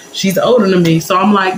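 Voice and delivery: usual speaking voice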